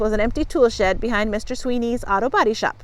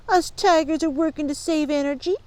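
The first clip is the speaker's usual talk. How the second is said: higher, self-important bully voice; declaring bully; 5 semitones higher than usual. A narrator's reading. Bugs claims a noble cause, declaring grandly. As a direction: proclaim it with put-on nobility. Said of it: "Bugs declared".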